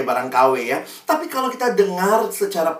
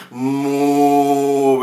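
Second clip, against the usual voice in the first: a short mock moo, louder; imitated cow moo; 2 semitones lower, 4 dB louder than usual. Preacher imitating a class of students mooing like a cow. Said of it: "Semua satu kelas langsung jadi sapi".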